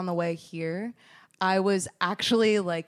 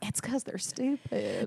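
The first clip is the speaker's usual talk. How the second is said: softer, slower mock confession; quiet joking aside; 7 dB quieter, slower than usual. Co-host teasing about Texans in a quiet mock aside.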